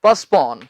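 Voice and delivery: postpone repeated low and firm as "puhs-PAWN"; correct postpone. Shown as a demonstration. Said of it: "टी इसमें हम नहीं बोलते".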